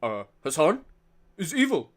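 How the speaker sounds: Asmongold impression. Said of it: "that's not how Asmongold sounds. He's like".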